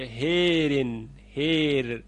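هیرن drawn out slowly with e; imala. Shown as a demonstration. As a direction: say هیرن stretched. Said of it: "به این صورت خونده".

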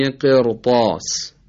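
slow قرطاس with a thick r; heavy ra. Shown as a demonstration. Teacher giving a new example, heavy r in قرطاس. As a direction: make the r in قرطاس full and heavy. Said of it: "خود ر هم تفخیم بشه".

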